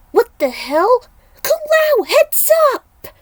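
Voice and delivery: very high, loud alarmed cry; shouted warning. Acted. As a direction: yell a warning to Kung Lao.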